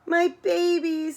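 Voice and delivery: high, loud, drawn-out mock wail; lightly acted tearful cry for her babies; mild acted sob. A narrator's reading. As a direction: wail it slowly, half-sobbing. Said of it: "sobbed Stumpy".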